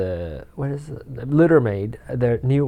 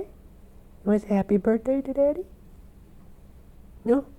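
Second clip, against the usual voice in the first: talking to a cat; 11 semitones higher, faster than usual. Real, not acted. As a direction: coo at the cat.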